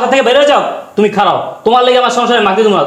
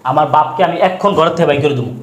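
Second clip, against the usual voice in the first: coaxing; 6 semitones lower than usual. Acted. Husband coaxing angry wife.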